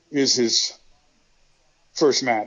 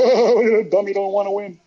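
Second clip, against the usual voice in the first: mocking teammates; 6 semitones higher than usual. Wrestlers teasing Bob for not wanting to win, higher mocking jeer. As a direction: rib him like the guys did.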